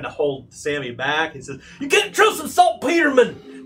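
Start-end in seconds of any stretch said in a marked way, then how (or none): 1.8-3.6 high, loud furious yell; angry shout. Acted. Sammy confronts the Salt Peterman, high and shouting. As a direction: shout him down in a rage. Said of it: "he says as he's, like, in your face".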